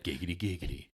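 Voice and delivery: low, soft cartoon catchphrase; lightly voiced giggity catchphrase, dropping away; Quagmire. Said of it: "Quagmire sitting there naked".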